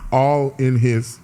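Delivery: "All in his" drawn out word by word; careful, half-speed "All in his"; slowed-down reading. Retold or imitated. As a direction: read "All in his" very slowly, deliberately. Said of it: "Slow down".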